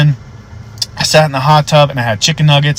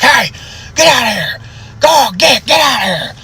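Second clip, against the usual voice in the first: high-pitched panicked shouting; freaking out; 11 semitones higher than usual. Retold or imitated. Old man at the window seeing demons, freaked-out shouting. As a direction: imitate an old man yelling at intruders. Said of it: "freaking out".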